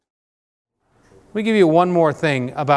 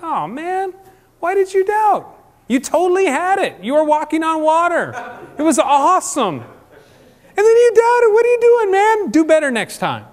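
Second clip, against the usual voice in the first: much higher, louder, laughing ribbing; coach's encouragement; 14 semitones higher, 6 dB louder than usual. Retold or imitated. Jesus ribbing Peter after he sinks, excited coach cheer. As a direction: cheer him on like a coach slapping his back. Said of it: "like a coach's, you know, smack on the back".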